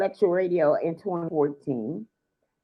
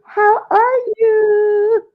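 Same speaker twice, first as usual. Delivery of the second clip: slow, sing-song high greeting; drawn-out greeting; 13 semitones higher, 8 dB louder, much slower than usual. Real, not acted. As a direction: stretch it out, high and loud.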